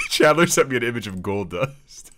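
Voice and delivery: high, loud, cracking up; laughing while talking. Real, not acted. Host sees the image he was sent, laughing. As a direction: squeeze the words out through laughter.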